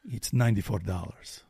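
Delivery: deadpan. Retold or imitated. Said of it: "And she said".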